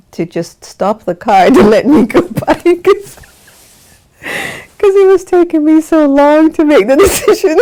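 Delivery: words squeezed out through high giggles; laughing. Real, not acted. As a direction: laugh helplessly while telling it. Laughing at own funny story.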